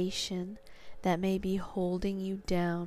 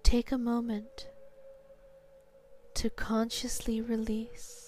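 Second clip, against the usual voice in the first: sleep story narrator; slower than usual. Acted. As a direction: guide them down into sleep, slowly. Guided hypnosis induction.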